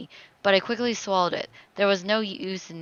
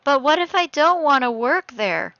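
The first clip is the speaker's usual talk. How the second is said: louder, higher, insistent protest; speaking up; 6 semitones higher, 6 dB louder than usual. Acted. Daughter challenges her father's career plan, speaking up. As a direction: push the objection out clearly. Repeats the objection after being scolded.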